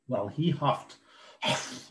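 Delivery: ordinary delivery, nothing marked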